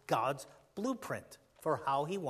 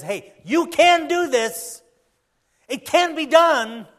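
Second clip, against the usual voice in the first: loud, high, drawn-out encouragement; rally them with a big, slow shout; positive, rousing; 10 semitones higher, 14 dB louder, slower than usual. Acted. Preacher voicing God to the congregation, positive rousing shout. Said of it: "He's positive, isn't he? He says".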